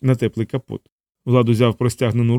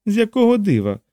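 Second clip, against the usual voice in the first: high-pitched woman, mildly icy; cold question; 10 semitones higher than usual. A narrator's reading. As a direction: challenge with a touch of coldness. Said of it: "холодно запитала Анжела". (холодне запитання високим голосом)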